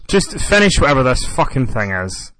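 fed-up, impatient demand; exasperated. Real, not acted. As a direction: cut in, out of patience with the rambling. Fed up with co-host's rambling story.

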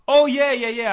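loud, high burst of yeahs; eager agreement. Retold or imitated. Agent admitting he watches wrestling, loud and eager. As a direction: jump in brightly and loudly.

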